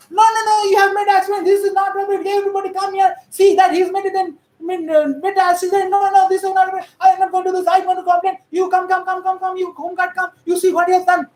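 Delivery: high, insistent police rant; rapid-fire hammed-up outburst of protest and orders; mock agitated shouting. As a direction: shout over everyone in a monotone torrent. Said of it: "police will start jumping like this".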